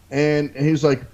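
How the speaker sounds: usual speaking voice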